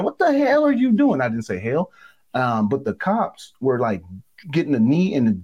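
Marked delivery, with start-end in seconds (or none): 0.0-1.2 high, loud yell at the field intruder; shouting at a fan who ran onto the turf; retold scream. Retelling yelling at a field intruder, high and loud. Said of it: "and I'm screaming".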